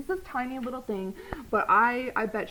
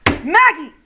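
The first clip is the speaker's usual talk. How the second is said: loud sharp call of the dog's name; 8 semitones higher, 16 dB louder than usual. Real, not acted. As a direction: snap her name to stop her. Calling the dog.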